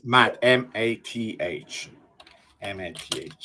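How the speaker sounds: letter by letter, slow and clear; spelled out slowly. Shown as a demonstration. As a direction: spell each letter deliberately. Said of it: "No, Matt".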